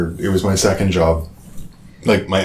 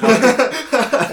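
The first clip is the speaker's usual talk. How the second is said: real outburst of laughter; big laugh; 14 semitones higher than usual. Real, not acted. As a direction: crack up laughing. Laughing at the joke.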